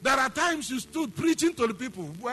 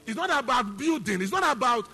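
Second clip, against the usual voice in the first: fiery preaching; 4 semitones higher, 7 dB louder than usual. Acted. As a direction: hammer the point home to the congregation. Preaching at full force.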